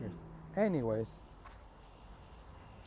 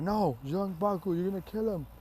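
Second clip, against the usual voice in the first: acted cry of warning; mock alarmed protest; 6 semitones higher than usual. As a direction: play at urgently calling him off. Acted plea to stop Baku.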